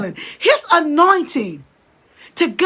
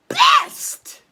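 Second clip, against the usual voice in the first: crying out. Real, not acted. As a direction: voice cracks high into a sob on the last word. Preacher breaks at giving God best.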